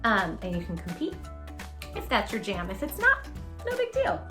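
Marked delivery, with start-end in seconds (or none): none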